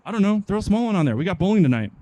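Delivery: acted dismissive worker; careless coworker. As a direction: brush it off, eager to clock out. Coworker's careless reply in acted exchange.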